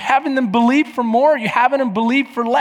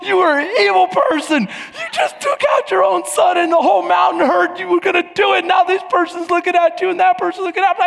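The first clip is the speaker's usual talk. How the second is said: high, indignant mock rant; scolding sisters; 8 semitones higher, 3 dB louder than usual. Sisters telling dad he is wrong, high indignant scolding. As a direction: imitate outraged kids piling on blame, higher pitched. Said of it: "his sisters are like".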